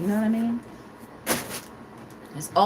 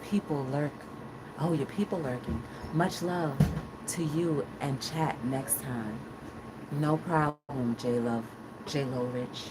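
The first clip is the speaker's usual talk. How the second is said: odd soft voice; 9 semitones lower, 8 dB quieter than usual. Real, not acted. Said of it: "I don't know why I'm talking like that".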